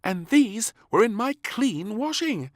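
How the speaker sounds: high, loud indignant maid; exclaiming maid. A narrator's reading. Maid shows beans found in her washing. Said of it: "exclaimed the maid".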